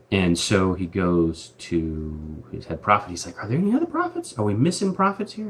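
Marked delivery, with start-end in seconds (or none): 3.4-5.5 high squeaky king Ahab voice, hammed-up; a silly, high, whiny king asking around; mock king voice. King Ahab voiced in retold story.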